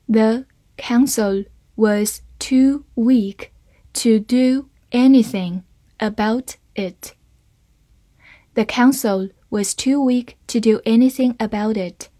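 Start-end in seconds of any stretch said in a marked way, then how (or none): 0.1-7.1 slow, careful model reading. Shown as a demonstration. Teacher reading the sentence slowly for students, slow and clear. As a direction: read it slowly, word by word. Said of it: "好，慢慢来读".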